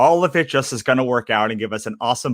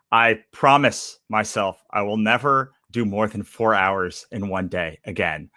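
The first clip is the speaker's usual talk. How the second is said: normal talking voice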